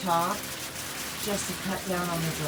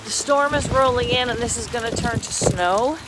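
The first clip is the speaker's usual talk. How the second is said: raised voice outside in the storm; loud over wind; 3 semitones higher, 8 dB louder than usual. Real, not acted. Outdoors talking over incoming storm.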